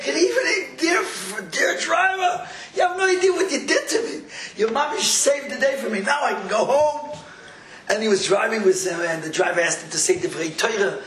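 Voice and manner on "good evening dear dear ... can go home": high, excited outpouring of thanks; acted overjoyed gratitude. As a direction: play the grateful driver, pitched high and gushing. Driver's retold overjoyed gratitude.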